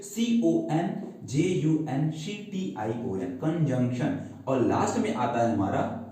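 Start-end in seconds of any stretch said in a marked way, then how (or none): 0.0-3.2 slow, letter by letter; spelling out. Shown as a demonstration. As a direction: spell it out slowly for the class. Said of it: "spelling को ध्यान से देखेंगे". (अक्षर-अक्षर धीरे-धीरे spelling बोलना)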